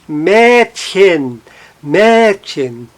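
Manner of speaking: "Mädchen" with a strong MÄD, weak chen; stress on MÄD. Shown as a demonstration. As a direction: punch the first syllable of "Mädchen". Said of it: "não está no hen".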